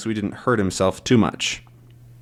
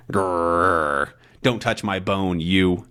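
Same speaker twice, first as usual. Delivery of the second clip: low growl, then talking as the dog; 4 semitones lower, slower than usual. Acted. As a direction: growl a possessive warning. Dog guarding bone in story.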